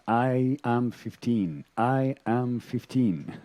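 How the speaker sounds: slow, deliberate, mantra-like repetition; slow repeated affirmation. Shown as a demonstration. Teacher showing students how to repeat a wish, slowly. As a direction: state it slowly like a wish you are affirming. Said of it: "I can ask and repeat".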